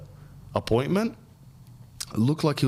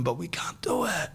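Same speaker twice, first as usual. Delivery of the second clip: a sudden unvoiced whisper; hushed mock confession; 7 semitones higher, 4 dB quieter than usual. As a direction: drop to a secret whisper. Mock aside about going out.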